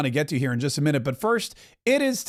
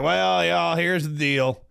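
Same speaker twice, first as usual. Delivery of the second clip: a light folksy Tennessee drawl; Tim Burchett impression; much slower than usual. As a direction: lean into a lightly voiced Southern drawl, laid-back. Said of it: "Tim Burchett is like".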